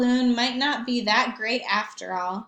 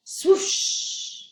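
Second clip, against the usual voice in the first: whooshing sound; 9 semitones higher, 3 dB louder than usual. Acted. Sound of scoring.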